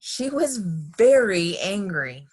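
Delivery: "very" stretched out slowly for emphasis; stress on very. A narrator's reading. Narrator on Missus Ralph's temper, slow heavy stress on "very". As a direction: draw out "very" with weight.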